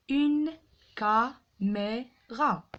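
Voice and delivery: "Une caméra" slowly as une, ca, mé, ra; syllable by syllable. Shown as a demonstration. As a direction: gaps between every syllable of "Une caméra". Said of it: "frappez les syllabes".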